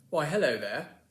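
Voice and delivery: hammed-up British greeting; British accent. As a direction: play the British cousin arriving. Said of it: "my British cousin here".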